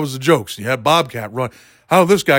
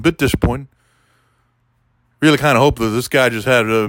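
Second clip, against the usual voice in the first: ordinary talk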